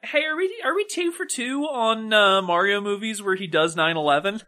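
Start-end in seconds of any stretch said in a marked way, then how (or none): none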